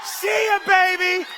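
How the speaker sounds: lightly voiced excited judge, laughing; a hint of a giddy old man cheering; Clarence Thomas. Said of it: "he go".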